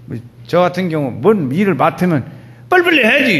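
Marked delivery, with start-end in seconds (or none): 2.7-3.3 high, loud burst of impatience; impatient urging. Acted. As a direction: hurry them along, pitched well up. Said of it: "이런 식이에요".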